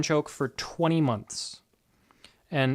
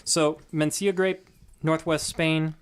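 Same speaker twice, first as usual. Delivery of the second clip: ordinary talk, nothing marked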